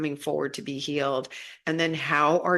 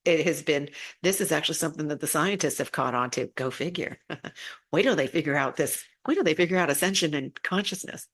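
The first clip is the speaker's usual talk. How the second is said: ordinary delivery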